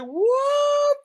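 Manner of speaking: very high drawn-out mock shriek; shocked. Vlogger retelling shock at the live video, high squealed disbelief. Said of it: "I was like".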